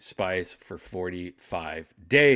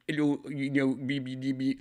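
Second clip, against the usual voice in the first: higher, put-on German accent; hammed-up German guy accent; weird German accent; 5 semitones higher than usual. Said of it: "Like, I had this weird accent".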